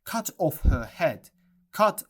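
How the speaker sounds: loud, high angry royal yell; furious shout. A narrator's reading. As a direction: bellow the order in rage. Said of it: "She looked at Alice and shouted".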